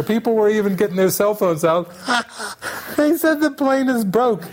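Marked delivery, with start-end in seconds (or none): 3.0-4.4 high-pitched mock phone report; squealed, amused imitation; gleeful retelling. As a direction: imitate someone bursting to pass on funny news. Laughing passengers phoning spouses.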